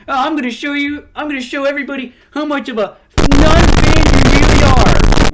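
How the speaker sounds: high-pitched mocking imitation of a rant; hammed-up tantrum, ending in a loud mock scream; mock whiny fanboy. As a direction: put on a high fanboy voice, building to a yell. Mockingly voices the other reviewer's rant.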